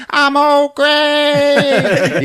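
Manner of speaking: high, drawn-out crazed yell; hammed-up maniac cry, very slow; Old Greg. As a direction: announce yourself in a loud, stretched screech. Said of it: "He's old Greg".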